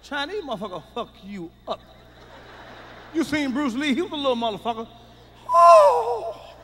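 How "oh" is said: kung fu yell. Retold or imitated. Bruce Lee karate cry.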